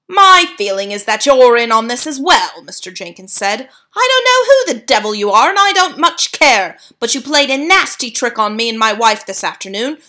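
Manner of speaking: loud, high, accusing bluster; indignant hotel guest losing his temper; angry father. A narrator's reading. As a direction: demand answers from the old woman. Mister Jenkins accusing the grandmother.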